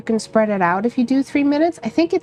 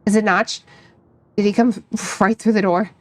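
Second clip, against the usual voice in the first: story narration; 4 semitones lower than usual. Acted. Reads visual novel narration.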